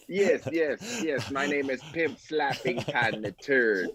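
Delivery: playful put-on Jamaican accent; hammed-up Jamaican patois voice. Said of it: "Is that your Jamaican accent?".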